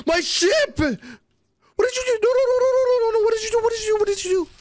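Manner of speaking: very high, loud frantic yelling; panicked shriek. Real, not acted. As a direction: shriek in disbelief. Finds his island destroyed.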